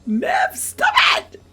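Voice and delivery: loud, high pleading yells; protective shouting. Pretend. Player defending Emmerich from Nev, hammed-up protective shouting. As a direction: shout it as a hammed-up rescue, climbing to a screech. Yells at game character to stop.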